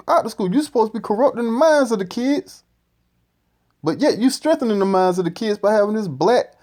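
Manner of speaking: high mock-angry voice, climbing at the end.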